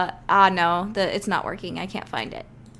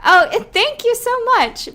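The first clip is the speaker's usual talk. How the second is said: excited thanks; 10 dB louder than usual. Real, not acted. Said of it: "Because I'm bored, just donated".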